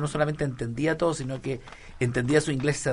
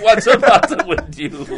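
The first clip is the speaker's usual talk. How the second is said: higher, louder, slow and laughing; 10 semitones higher, 10 dB louder, slower than usual. Shown as a demonstration. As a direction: laugh the whole line out, stretched. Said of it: "con risa".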